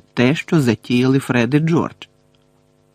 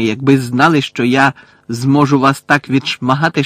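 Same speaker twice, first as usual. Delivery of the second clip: loud, emphatic menacing rant; spiteful caretaker, gloating; 5 dB louder than usual. Acted. Caretaker threatening Harry with punishments to come, spiteful gloating. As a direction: relish every threat as a vengeful old caretaker.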